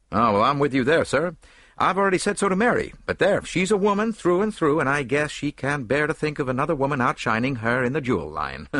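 brash actor talking over his wife; hearty big actor. A narrator's reading. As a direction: agree loudly, man to man. Story figure, big actor agreeing.

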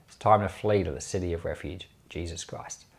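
ordinary talk, nothing marked

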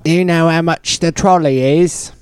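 high, loud Cockney; Cockney accent. Shown as a demonstration. Host demonstrating accents to co-host, Cockney accent. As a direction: belt it out in broad Cockney. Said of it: "And then there's Cockney".

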